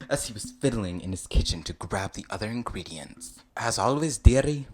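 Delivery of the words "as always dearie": loud, attempted Scottish endearment; Scottish accent. Shrek answering you at the cauldron, Scottish accent. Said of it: "in a smooth Scottish accent".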